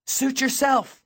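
high-pitched, restrained shout; dismissive shout. A narrator's reading. Girl gives up on stubborn boy in crowd, shouting. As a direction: call it out and wash your hands of them. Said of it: "she shouted in my ear".

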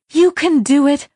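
high, loud urging; urgent encouragement. A narrator's reading. The voice urging the fleeing girl, loud encouragement. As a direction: push her on, quickly. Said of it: "the voice said from behind the door".

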